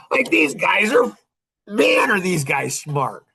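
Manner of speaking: high, stretched-out marvelling; acted admiration. Marvelling at the men who found the answer, mildly hammed-up awe. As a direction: marvel at them, drawing it out.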